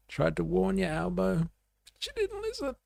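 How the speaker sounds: pitched far up, a teasing told-you-so; mock singsong warning. Mocking Bibi's warning to Albo.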